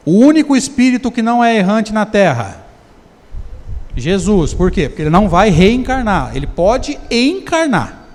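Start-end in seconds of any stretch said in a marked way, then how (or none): none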